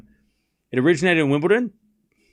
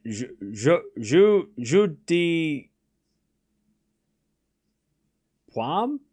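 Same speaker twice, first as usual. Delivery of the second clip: halting, drawn-out attempt at French; much slower than usual. Streamer reading the old French name of tennis, tentative French accent. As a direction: sound out French slowly, ending in a rising question. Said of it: "That sounded French".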